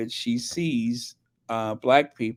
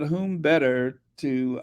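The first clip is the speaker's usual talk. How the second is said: usual speaking voice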